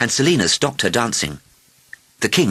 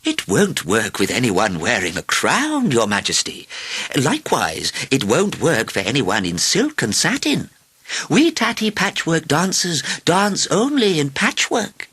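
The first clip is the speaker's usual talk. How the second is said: put-on mildly scornful old lady, measured pace; 6 semitones higher than usual. A narrator's reading. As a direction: refuse the king with slow disdain. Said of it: "looked at him with scorn".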